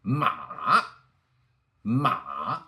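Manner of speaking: MA dipping down, then running back up; long dip-and-rise MA; third tone. Shown as a demonstration. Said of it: "Third tone".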